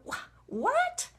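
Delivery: high-pitched incredulous "what?"; acted disbelief. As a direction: balk at it in a leaping, high voice. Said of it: "I read it out loud and I go".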